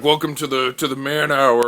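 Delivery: welcoming the guys to man hour, loud and macho; macho bro toast. Acted. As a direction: pump up the table like a meathead host. Macho bros toast at table.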